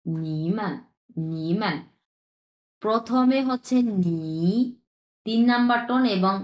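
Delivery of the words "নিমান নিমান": নিমান with a dipping third tone, then a light unstressed মান; Chinese nǐmen. Shown as a demonstration. Teacher models নিমান slowly for students, dipping then neutral. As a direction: dip on নি, let মান fall away toneless. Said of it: "চার নম্বরে আছে হচ্ছে নিমান".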